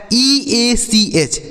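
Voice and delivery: slow letter-by-letter spelling. Shown as a demonstration. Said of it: "इसे हम पढ़ते हैं ईच". (अक्षर-अक्षर धीमे बोलना)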